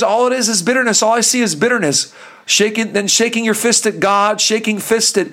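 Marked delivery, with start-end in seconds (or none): none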